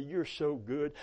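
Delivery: ordinary talk, nothing marked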